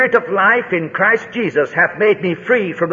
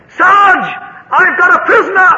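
high, loud shout from a shell hole; distant shout; 11 semitones higher, 6 dB louder than usual. Retold or imitated. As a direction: yell the news across no man's land. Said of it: "shouting to him right out".

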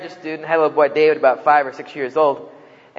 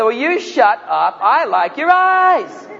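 exasperated insisting; 6 semitones higher, much slower than usual. Retold or imitated.

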